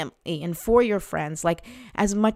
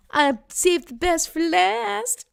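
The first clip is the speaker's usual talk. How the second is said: much higher, slow, singsong tease; playful teasing; 11 semitones higher, 4 dB louder, slower than usual. Real, not acted. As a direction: tease mom with a lilting high voice. Teasing mom before her reading.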